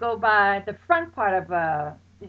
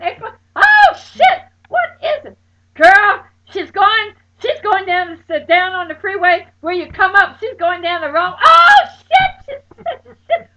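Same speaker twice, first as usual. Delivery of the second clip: high, loud panicked screams and crying; panicked screaming; 8 semitones higher, 12 dB louder than usual. Retold or imitated. Friend reporting the car down the wrong way, panicked screaming. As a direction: shriek in terror, near tears.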